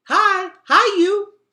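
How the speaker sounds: put-on very high, loud toddler squeal; a thrilled two-year-old calling out; delighted toddler. A narrator's reading. Boots delightedly greeting the Underlanders. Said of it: "looking completely delighted".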